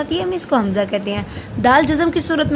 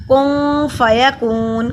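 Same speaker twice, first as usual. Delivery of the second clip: slow, louder recited word; recited demo word; 5 dB louder, much slower than usual. Shown as a demonstration. Teacher models the word for students in slow chant. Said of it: "کن پڑا آگے حروف الکی نہیں ہے".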